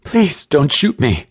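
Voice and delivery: high, slow terrified plea; tearful high-pitched pleading; shrill. A narrator's reading. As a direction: beg shrilly for your life. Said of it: "came the shrill voice".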